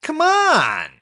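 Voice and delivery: drawn-out plea to the computer; begging the frozen screen, high and loud; frustrated plea. Real, not acted. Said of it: "now I'm getting frustrated".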